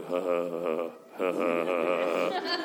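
low, slow holy laughter; imitated deep laugh. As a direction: mimic a preacher's low ha ha. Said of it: "I hear him go".

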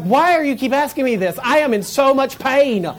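loud, high exasperated outburst; mock back talk. Hurt boy to his questioning mother, mock exasperated shout. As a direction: pretend to snap at mother in pain. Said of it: "I would never speak to her like that".

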